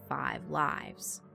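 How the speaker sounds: ordinary delivery, nothing marked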